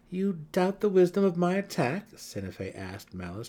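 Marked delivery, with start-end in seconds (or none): 0.1-2.1 high, loud, mocking question; amused challenge. A narrator's reading. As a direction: challenge her with suppressed amusement. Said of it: "trying to keep a chuckle under her breath".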